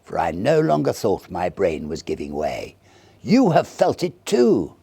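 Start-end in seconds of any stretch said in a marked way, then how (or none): 3.2-4.8 high, loud and slow, savouring it; a loud, slow, vindicated declaration; triumphant. A narrator's reading. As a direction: press the point home gleefully.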